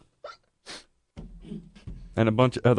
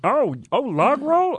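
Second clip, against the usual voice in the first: excited squeal; 3 dB louder, slower than usual. Pretend. Excited about log rolls.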